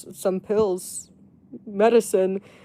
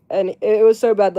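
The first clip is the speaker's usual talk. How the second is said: normal talking voice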